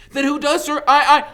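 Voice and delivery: put-on high, loud, breathless boy's voice; panicked Harry. Harry wakes and urgently warns Dumbledore, high and panicked. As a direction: blurt it out in a rush of alarm.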